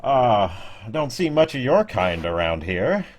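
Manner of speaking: calls out loud and high, slowing on "explorers"; sudden booming welcome from a stranger; gruff stranger by the fire. Acted. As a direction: greet the travellers like a showy campfire local. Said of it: "he looks over and goes".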